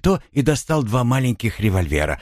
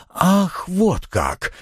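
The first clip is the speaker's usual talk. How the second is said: exclaiming; 12 semitones higher, much slower than usual. A narrator's reading. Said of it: "воскликнул Сольберг".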